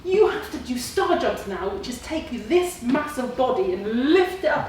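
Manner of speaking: a touch of the fretful inner critic; mock worried inner voice; voice of Vera. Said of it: "I called her Vera".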